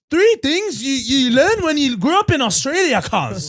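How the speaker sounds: put-on high, loud Australian wog accent; Aussie wog accent. Imitating Ted's Australian videos, loud wog accent. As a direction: belt it like an Aussie video intro. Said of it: "He does videos like that".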